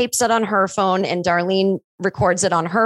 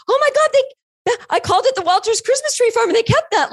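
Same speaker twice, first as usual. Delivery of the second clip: giddy excitement; 14 semitones higher than usual. Retold or imitated. Writer seeing her details kept in the movie, squealing excitedly. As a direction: gush like a thrilled fan.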